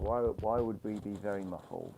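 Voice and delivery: dull, boxed-in mic sound; muffled audio. Real, not acted. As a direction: speak through a muffled mic. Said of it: "big up, muffled audio".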